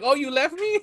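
high-pitched mock reproach; imitated girlfriend. Girlfriend to the boyfriend who ran off, high mock reproach. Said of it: "and the girlfriend's like".

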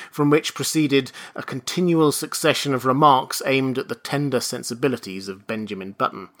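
ordinary delivery

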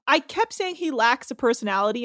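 usual speaking voice

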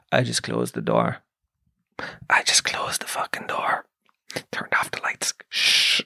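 hushed, guilty whisper while hiding; sneaky whisper. Retold or imitated. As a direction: whisper like you're hiding behind the door. Sneaking, hiding from old man.

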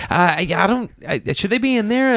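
high, hesitant worried parent; nervous parent. Retold or imitated. Friend balking at the kid near the tools, nervous.

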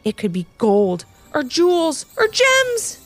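high, slow, building excitement; dreamy excited exclamation, climbing in pitch; exclaiming. Acted. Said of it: "exclaimed Ivy".

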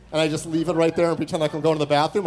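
ordinary delivery

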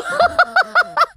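high-pitched giggle; a bright squealing laugh. Real, not acted. Laughing at her friend's remark, high giggle.

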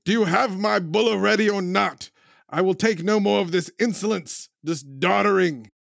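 imperious customer demanding his order; shout. Acted. Customer shouting at the shop about his bulla, a mock shout. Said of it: "I'm just gonna stand up and shout".

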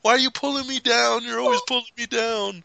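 high-pitched acted protest; mock whiny complaint. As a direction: whine it as if being dragged. Voicing the character's complaint.

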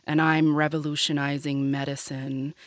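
low, soft, level put-on voice; imitation of a deliberately deepened voice; Elizabeth Holmes. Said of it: "I'll try and do it now".

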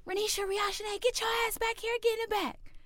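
very high mock auntie summons; imitated aunt calling. Auntie ordering her cousins to the back, imitated high and sharp. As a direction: call the kids in a high-pitched auntie voice. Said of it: "my auntie calls my cousins".

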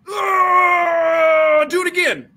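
mock agony, still eager; pained groan.

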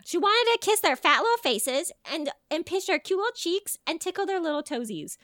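high-pitched cooing over babies; high, cutesy gush; baby-talk gushing. Pretend. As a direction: coo it like a doting auntie pinching cheeks. Gushing over babies' cheeks and toes.

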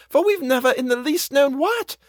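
high-pitched housekeeper's protest; declaring. A narrator's reading. Housekeeper defends the boy to the governess, high protest. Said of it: "Missus Gross declared".

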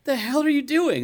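high-pitched retold telling-off; mock indignant. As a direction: confront them in exaggerated disbelief. Retold confrontation with teens on lawn.

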